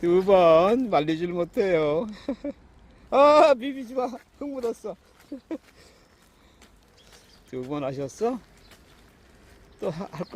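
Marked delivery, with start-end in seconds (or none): 3.1-5.0 high laughing scold to a pet; talking to a dog. Real, not acted. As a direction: giggle and tell the dog off. Dog rolling in dirt. (웃으며 강아지를 높은 목소리로 나무라기)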